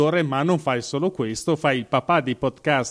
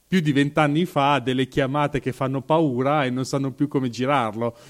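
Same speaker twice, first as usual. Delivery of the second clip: ordinary delivery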